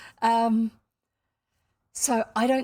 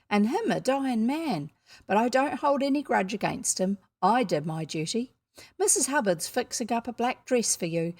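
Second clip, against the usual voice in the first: brisk, matter-of-fact scolding voice; Ellen, blunt housekeeper figure; 3 semitones higher, 3 dB louder than usual. Acted. Ellen telling the orphaned girl about arrangements, blunt and emphatic. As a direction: lay out the practical news bluntly, with emphatic beats.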